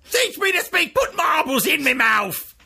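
very high, hammed-up Cockney squawk; Cockney woman impression. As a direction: play a cartoon Cockney flower seller, drawing out the last line. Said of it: "you're the Cockney woman in it".

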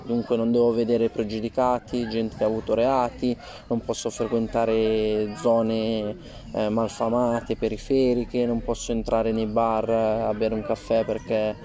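ordinary talk, nothing marked

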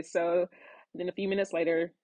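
ordinary talk, nothing marked

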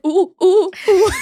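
mock pained "ooh"s, high and loud. Imitating a sick woman caught short on the steps, pained. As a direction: yelp little high oohs, imitating sudden cramps.

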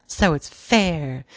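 high slow crowing. A narrator's reading. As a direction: stretch it out triumphantly.

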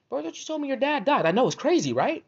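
high-pitched incredulous put-on voice; mock excuse exchange. Making up crazy excuses to leave, high mock disbelief.

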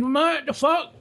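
a sudden high, incredulous "what the fuck"; shocked outburst. Retold or imitated. Friend acting out his shock at the Anne Frank house, high incredulous yelp.

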